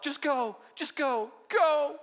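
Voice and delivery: a movie owner sending the dog off, lightly hammed; shooing the dog. Movie owner pleads with the loyal dog to leave, mock pleading. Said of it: "the movies when they say the dog".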